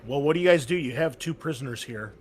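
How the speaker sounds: ordinary talk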